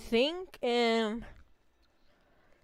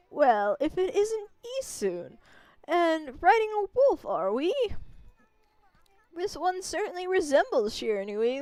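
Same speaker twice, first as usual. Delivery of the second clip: lightly voiced kindly village grandma; a gentle old lady warning the traveler; 6 semitones higher than usual. Old grandma greeting the wolf and its rider, lightly voiced.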